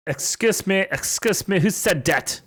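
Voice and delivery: high-pitched mock yell; indignant call, pitched well up. A narrator's reading. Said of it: "yelled Mister Norris".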